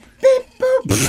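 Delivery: very high falsetto chirp; piping robot-toy voice; high beep boop. Shown as a demonstration. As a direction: nail the squeaky two-note beep. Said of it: "Oh, there it is".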